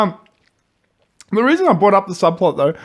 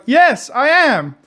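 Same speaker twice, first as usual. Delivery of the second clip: slow, high, flatly matter-of-fact; deadpan mock reply; 10 semitones higher, 5 dB louder, much slower than usual. Retelling a movie scene, the deaf man answers slowly. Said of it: "he's like".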